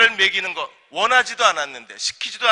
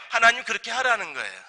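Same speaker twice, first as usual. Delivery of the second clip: normal talking voice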